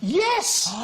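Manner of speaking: high, drawn-out cry of yes; elated yes. Real, not acted. Host to panellist who guessed slipper, elated. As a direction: burst out high in triumph. Contestant finally guesses the answer.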